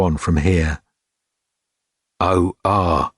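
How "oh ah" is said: resigned. A narrator's reading.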